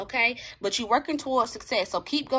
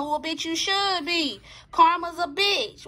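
sassy comeback; 5 semitones higher, 3 dB louder than usual. Real, not acted. Answering the person's fear of karma.

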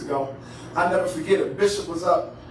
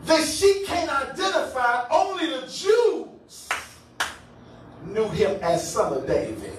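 high sermon cadence with stretched key words; emphatic preaching; 8 semitones higher than usual. Real, not acted. Preacher expounding the text to congregation, emphatic preaching. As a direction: preach the point, leaning on the big words.

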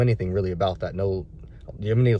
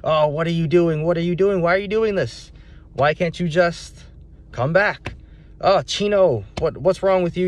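higher, exasperated scolding, short of a real yell; exasperated mock yelling; 7 semitones higher than usual. Owner chasing the dog in circles, exasperated mock yelling. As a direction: act out an owner fed up with a runaway dog. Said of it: "yelling at him".

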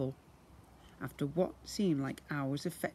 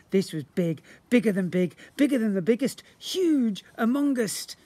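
louder and higher with each word; building excitement; 5 semitones higher, 6 dB louder than usual. A narrator's reading. Narrator hypes how big it was, building loud. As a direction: pile it on, building to huge.

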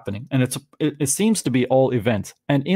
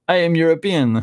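higher-pitched mock European accent; 5 semitones higher than usual. Showing off the neckwear as a European in North America, mock European accent. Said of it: "That's a great accent".

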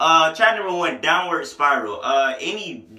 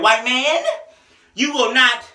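high, loud, slowed preaching with heavy pauses; 9 semitones higher, 5 dB louder, slower than usual. Retold or imitated. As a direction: preach it at him, a word at a time.

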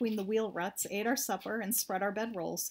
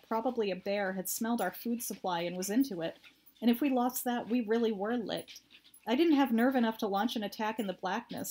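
usual speaking voice

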